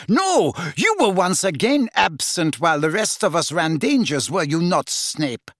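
high, heated accusation; indignant villainess tirade; furious. A narrator's reading. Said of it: "said Bellatrix furiously".

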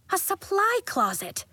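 high, bright-eyed discovery; surprised reveal. A narrator's reading. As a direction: unveil it like a surprise. Narrator reveals the secret closet.

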